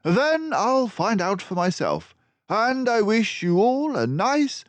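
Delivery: high, loud cheery voice; triumphant detective. Acted. Basil wishing crooks a long prison stay. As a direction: send them off gleefully.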